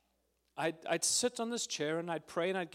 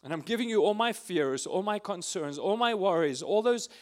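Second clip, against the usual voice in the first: prayer; 2 semitones higher, 4 dB louder than usual. Acted. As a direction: start hushed, then pour out the fears louder. Voices his prayer to Abba.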